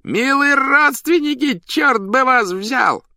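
high, loud clown cries with stretched endings; shouting. A narrator's reading. Clown greets cat and dog as dear relatives, shouting. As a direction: holler each greeting at the top of the voice. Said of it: "закричал хозяин".

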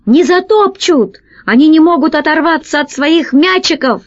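high, insistent comeback; shouted retort. A narrator's reading. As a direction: argue back across a distance. Pirates argue by shouting. (настойчивый крик в ответ)

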